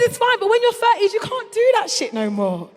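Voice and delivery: ordinary talk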